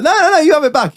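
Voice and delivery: high-pitched, insistent protest; protesting refusal. Retold or imitated. Finder handing the tool back to its owner, protesting.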